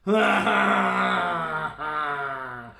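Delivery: loud, hearty goat laugh; big goat laugh. Acted. The big goat laughs at the troll, loud. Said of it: "only laughed".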